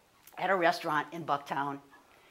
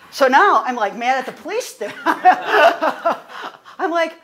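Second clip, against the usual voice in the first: laughing while talking, louder; 6 semitones higher, 10 dB louder, slower than usual. Real, not acted. Telling how the officer asked about a gun, laughing. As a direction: laugh through the words in disbelief.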